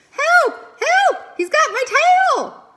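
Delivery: high, loud terrified cries for help; panicked screaming. Acted. Young beaver screams for help from the pond. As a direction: scream for help with a fish on your tail.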